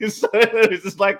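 ordinary talk, nothing marked

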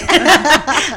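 a loud, high, real laugh bursting out; burst of laughter. Real, not acted. Guest laughing about the short half-hour slot.

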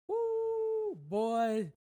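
sudden high loud exclaim; overwhelmed whoop. Real, not acted. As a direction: let it burst out of you. Overwhelmed recalling prayer moment.

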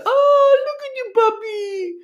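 very high, gushing puppy voice. Retold or imitated. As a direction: mimic women cooing over a puppy in a squeaky high pitch. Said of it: "they go into this voice".

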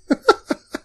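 sudden high giggle; high burst of laughter. Real, not acted. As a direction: laugh shooting up into a high squeal. Laughing at main character dies.

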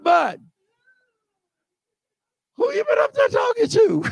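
frightened Israelites begging Moses to send God back; panicked crowd. Retold or imitated. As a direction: scream at Moses in a hammed-up panic.

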